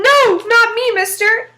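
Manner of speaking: high loud frantic denial; frantic devil. Acted. Devil flung around the shop begging Jack, high and loud. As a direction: cry out while being thrown around.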